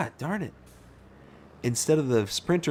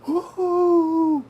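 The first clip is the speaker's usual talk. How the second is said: freaking out; 5 dB louder than usual. Retold or imitated. Driver cheering as the van gets pulled over, whooping. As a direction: gasp then a high, loud whoop. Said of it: "Like, I'm freaking out".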